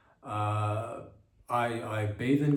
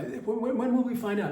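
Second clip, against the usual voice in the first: eager whine; 11 semitones higher, 4 dB louder than usual. Real, not acted. Eager election question.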